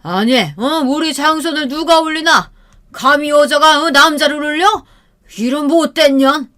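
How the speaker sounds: 높고 크게 호통치는 취한 노인; 며느리를 꾸짖듯 높이 호통; 화난 시아버지. Retold or imitated. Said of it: "시아버지가 갑자기 화를 냈어요". (며느리에게 화난 시아버지의 높은 호통)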